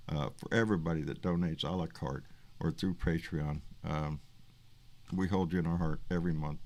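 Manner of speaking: low, soft and sincere; quietly humble gratitude, pitched well down; heartfelt thanks. Real, not acted. Host thanking donors who keep the show afloat, heartfelt.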